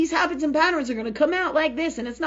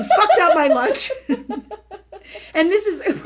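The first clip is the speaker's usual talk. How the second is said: helpless laughing; 6 semitones higher, 6 dB louder than usual. Real, not acted. Confessing her lunch tantrum to listeners, laughing hard. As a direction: crack up while saying it.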